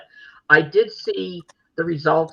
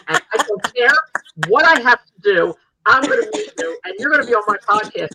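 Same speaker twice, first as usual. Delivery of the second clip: high, loud, insistent promise; determined vow; 5 semitones higher, 6 dB louder, faster than usual. Retold or imitated. Fan vowing to get wrestler on podcast, determined. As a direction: push each phrase out with determination. Imagined vow addressed to her idol.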